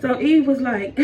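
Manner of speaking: ordinary delivery, nothing marked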